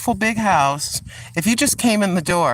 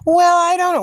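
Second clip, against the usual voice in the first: mock buyer; 13 semitones higher, 6 dB louder than usual.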